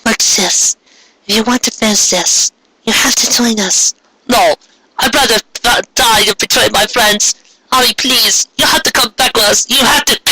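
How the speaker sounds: lightly voiced pleading girl; voiced sister pleading.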